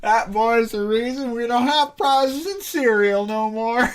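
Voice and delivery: slow reread, giggling throughout; laughing through it. Real, not acted. Player repeats the line while laughing, slow and giggling.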